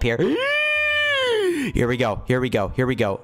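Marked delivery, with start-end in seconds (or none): 0.2-1.7 long, very high squeal; panicked squeal. Real, not acted. Player trying to get up from the tsunami, panicked squeal. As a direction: squeal high as the wave comes.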